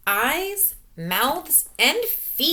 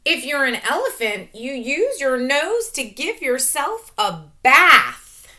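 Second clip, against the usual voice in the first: lively read; 5 semitones higher, 7 dB louder than usual. Acted. Reading book aloud.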